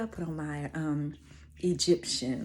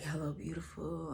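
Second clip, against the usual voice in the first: sultry; 2 semitones lower, 11 dB quieter than usual. Retold or imitated. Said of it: "his sexy, sultry voice".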